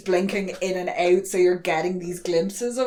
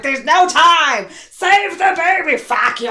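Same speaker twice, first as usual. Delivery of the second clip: slow, high, hammed-up yelling; mock frantic shout; 9 semitones higher, 6 dB louder, slower than usual. Host imitating Rosalie with the scalpel, loud mock-frantic shouting. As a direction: yell it in drawn-out acted urgency.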